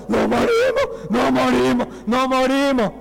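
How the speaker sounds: high, repeated cries of alarm; panicked yelling. Acted. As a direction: yell it like a frightened crew going under. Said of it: "lo ve a todos gritando".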